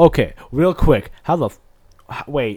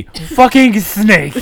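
mock shout; 5 semitones higher, 5 dB louder, much slower than usual. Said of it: "yes, that would be me".

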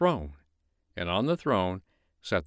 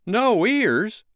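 much higher, louder startled cry; shocked snowman; astonished high outcry; cried in surprise; 13 semitones higher, 8 dB louder than usual. Acted. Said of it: "cried the snowman".

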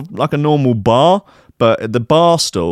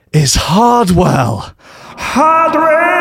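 slow then shouted high name; dramatic reveal; 11 semitones higher, 4 dB louder, much slower than usual. A narrator's reading. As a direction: build to a yelled big reveal.

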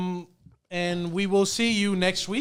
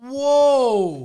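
loud surprised exclamation; surprised whoa; 6 semitones higher, 8 dB louder than usual. Real, not acted. Surprise at poster.

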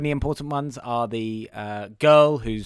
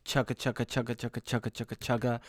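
quick quiet chugga; soft train chugging; 3 semitones lower, 8 dB quieter, faster than usual. Retold or imitated. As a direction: mimic a train softly. Imitating train sound.

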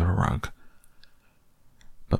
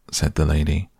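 usual speaking voice

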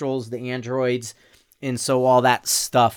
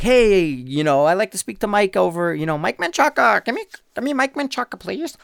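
mock caller voice; 8 semitones higher than usual. Prank call voice to Mile High.

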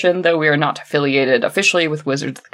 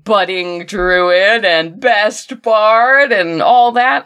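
slow mock grandeur; 3 semitones higher, 3 dB louder, much slower than usual. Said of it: "repeating players' titles".

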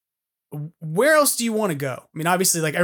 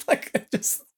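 usual speaking voice

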